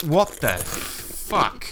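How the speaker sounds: very slow, drawn out "what the fuck"; stunned by the noise; disgusted surprise. Acted. Loud eating in clip.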